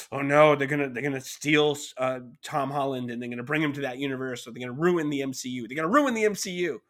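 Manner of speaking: mock outrage, rising in pitch at the end; angry friend. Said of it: "he was just very angry".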